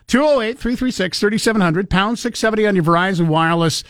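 upbeat announcer reading the number; radio call-in promo. Real, not acted. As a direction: pitch the phone number brightly.

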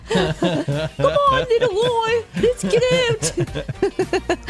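high, laughing mock-Scottish coaxing; Scottish accent. Co-host mimics the man coaxing a squirrel out, mock Scottish accent. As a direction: imitate a Scottish accent in a high, playful lilt. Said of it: "I love the Scottish accent".

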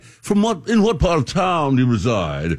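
mock-pompous formal question, starting high; pompous and arrogant. Host demonstrating pomposity to co-host, pompous and arrogant. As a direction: put on haughty, over-proper airs. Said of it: "That sounds pompous and arrogant".

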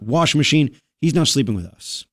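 ordinary delivery

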